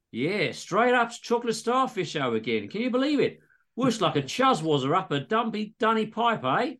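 high, hammed-up Aussie bloke; chatty Aussie patient telling the doc; exaggerated Aussie accent. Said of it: "all dialogue is in exaggerated Aussie accents".

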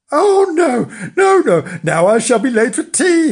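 high, loud flustered wail; loud high-pitched dismay; alarmed. A narrator's reading. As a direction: cry out in rising alarm at being late. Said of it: "he was alarmed to see".

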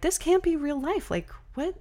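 high, incredulous what; can't believe what you're hearing; disbelief. Retold or imitated. Family staring at each other, high incredulous disbelief.

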